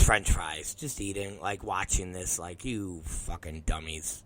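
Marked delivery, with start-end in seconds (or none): 2.6-4.2 very low, quiet, slow judgment; deadpan contempt. Retold or imitated. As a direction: judge them under your breath. Brother silently judging while eating.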